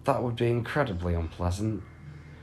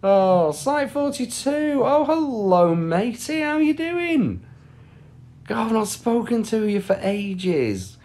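much higher, slow, cartoonish greeting voice; silly high greeting; 12 semitones higher, slower than usual. Streamer greeting a chat regular in a high silly voice. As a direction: squeak a hello to an old friend.

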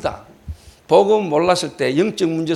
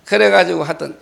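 ordinary talk, nothing marked

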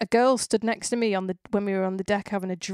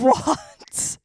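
mock surprise; 5 semitones higher, 6 dB louder than usual. Surprised at neighbour-town coincidence.

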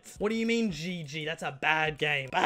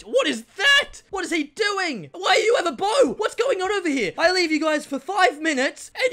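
higher and louder, stretched out in disbelief; baffled yelling; 12 semitones higher, 7 dB louder than usual. Real, not acted. Player finds an enemy with a bow, baffled yelling. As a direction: cry out at the weird sight.

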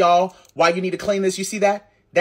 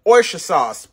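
usual speaking voice

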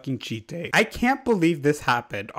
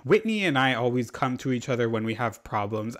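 ordinary talk, nothing marked

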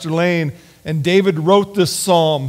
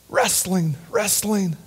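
ordinary delivery

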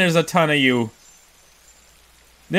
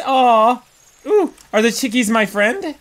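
ordinary talk

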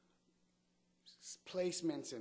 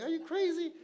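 very high, loud disbelief; mock incredulous waiter; 9 dB louder than usual. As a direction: squawk it as a scandalized waiter, half laughing.

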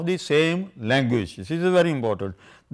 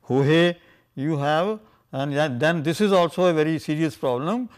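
ordinary talk

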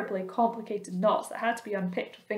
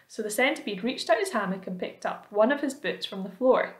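ordinary delivery

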